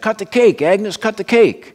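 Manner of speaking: a hint of an impatient diner man; lightly voiced insistent urging. A narrator's reading. Fat guy hands Agnes a knife, lightly voiced urging. Harry urges Agnes to cut cake.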